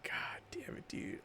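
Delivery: barely voiced, hushed curse; whispered curse. Fan whispering a curse on realizing he missed the creator. Realizing who it was, muttered.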